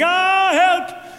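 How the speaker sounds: high, loud panicked yell for help; a sudden high scream for help; cry for help. Retold or imitated. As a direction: shout it like you're being dragged down the street. Dragged by motorcycle, calling out.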